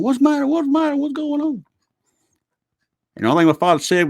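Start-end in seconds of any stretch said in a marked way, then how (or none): 0.0-1.6 high, fretful mom calling out; mother anxiously asking what's wrong; worried mom. Retold or imitated. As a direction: imitate Mom panicking in the background. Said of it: "Mom in the background going".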